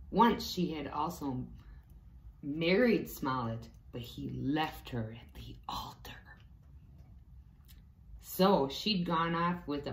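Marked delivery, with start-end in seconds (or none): 3.9-6.1 hushed, secret-sharing whisper; whispered aside. A narrator's reading. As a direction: let the listener in on a scandal. Storyteller's gossipy aside.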